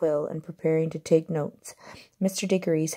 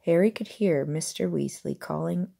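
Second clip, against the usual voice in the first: ordinary delivery, nothing marked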